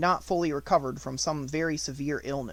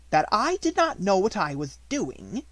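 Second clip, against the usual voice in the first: higher, louder outraged question; gasping disbelief; 7 semitones higher, 5 dB louder than usual. A narrator's reading. Headmistress gasping in disbelief at a pupil. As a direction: repeat it back in shock. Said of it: "Miss Minchin fairly gasped".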